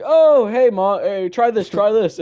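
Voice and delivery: high, loud, eager offer; over-eager greeting. Retold or imitated. Friend acts boy offering mom his drink, over-eager. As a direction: call out to mom too keenly.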